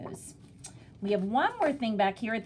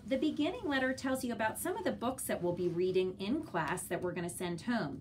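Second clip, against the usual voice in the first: usual speaking voice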